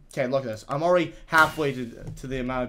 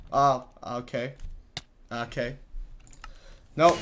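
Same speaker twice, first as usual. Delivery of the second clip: normal talking voice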